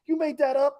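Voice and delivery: high disbelieving question; can't believe the prank; incredulous question. Retold or imitated. Asking Jerome about the prank, high disbelief. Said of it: "I go".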